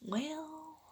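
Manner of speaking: soft, faltering start; boy caught arriving late, hesitant; hesitant stutter. Acted. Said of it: "Marco stuttered".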